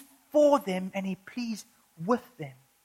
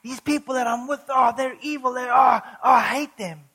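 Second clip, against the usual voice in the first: louder, whiny griping that builds to a shout; hammed-up grumbler venting about his neighbours; a put-on complainer, rising to a mock outburst; mock moaning complaint; 5 dB louder than usual. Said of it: "He doesn't say".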